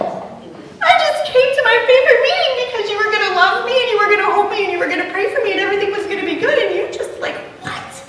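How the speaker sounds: high, fast, hurt outburst; indignant disbelief. Retold or imitated. Woman rebuked at prayer time, protesting high, fast and hurt. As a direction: squeal it in wounded disbelief.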